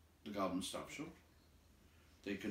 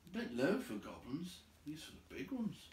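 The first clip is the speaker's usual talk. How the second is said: Bilbo thinking; 8 semitones higher than usual. A narrator's reading. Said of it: "thought Bilbo".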